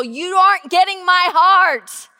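loud insistent plea; pleading. Retold or imitated. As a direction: press the words on them. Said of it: "telling them very openly".

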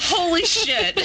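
high-pitched, laughing astonishment; playful, high retold shock; mock amazement. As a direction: act blown away through laughter. Acted amazed reaction amid laughter.

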